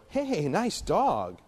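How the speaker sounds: high, drawn-out slow coo at the dog; mock dog-lover greeting; gushing at the dog. Shown as a demonstration. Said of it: "if I come up and I go".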